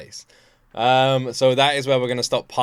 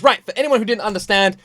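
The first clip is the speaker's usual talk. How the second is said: louder, higher mock teacher voice; imitating Taylor explaining; 8 semitones higher, 8 dB louder than usual. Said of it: "Taylor just goes".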